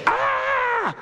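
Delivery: high startled yelp of dismay; shocked cry. Retold or imitated. Jacob lifting the veil, shocked yelp. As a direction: yelp like you just saw the wrong bride.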